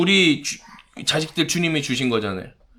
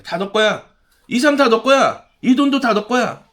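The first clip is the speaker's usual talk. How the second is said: lightly voiced devil, rising higher and louder; tempting devil; 7 semitones higher, 4 dB louder than usual. Devil tempting a believer to own everything, insistent raised voice. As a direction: urge it as the devil, pitch climbing. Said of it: "마귀의 첫 번째 속삭임은".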